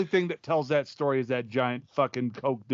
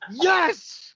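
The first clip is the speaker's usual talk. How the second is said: mock eager yes. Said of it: "Tony's like".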